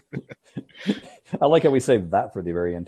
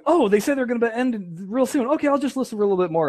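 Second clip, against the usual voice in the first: high, quick listener voice; chirpy patient listener; 12 semitones higher than usual. Retold or imitated. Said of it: "the listeners going".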